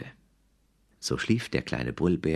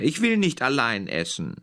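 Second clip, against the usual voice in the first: higher, sulking little bear; whiny cub; 8 semitones higher than usual. A narrator's reading. The cub refusing to eat alone, whining high. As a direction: whine like a pouting cub. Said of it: "brüllte er".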